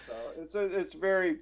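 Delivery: ordinary talk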